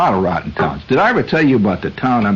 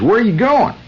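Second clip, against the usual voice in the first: much higher mock Southern voice; gas station attendant; 13 semitones higher than usual. Attendant quizzing the driver on his route, in a high Southern voice. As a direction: play the nosy attendant high. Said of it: "guy says".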